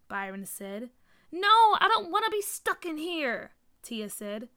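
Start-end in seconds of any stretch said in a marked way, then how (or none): 1.3-3.5 high, loud wail of refusal; distressed protest. A narrator's reading. Tia stuck in the box, distressed protest. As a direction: protest loudly at being trapped.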